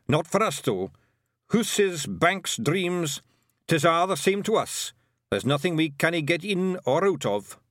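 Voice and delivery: cocky Scottish pixie chief; boastful Scottish pixie. Acted. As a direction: brag item by item as a little Scotsman.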